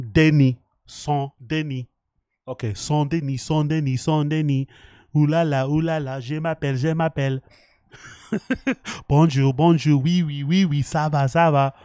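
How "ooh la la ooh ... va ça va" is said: mock French accent. Mock French phrases after French lesson.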